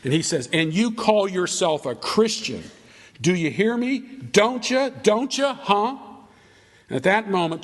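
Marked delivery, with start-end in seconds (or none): 0.5-6.0 high-pitched indignant rant, climbing on each question; ballistic mock yelling. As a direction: act out a drunkard railing at her. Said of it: "goes ballistic and he starts yelling at her".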